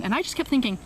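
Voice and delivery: ordinary delivery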